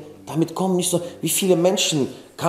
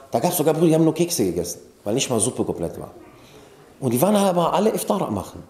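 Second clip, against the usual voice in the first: ordinary delivery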